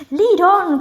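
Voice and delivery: high, loud eager shout; eager cry. Acted. Greedy rat crying out to follow the duck. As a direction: cry out hungry for the prize. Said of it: "cried the rat".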